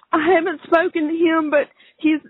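ordinary talk

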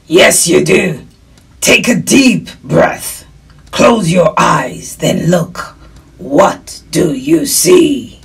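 loud, slow wolf voice; wolf mentor. Acted. Wolf guiding a lost girl to look, slow creature voice. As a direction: coach the child slowly, as a wolf.

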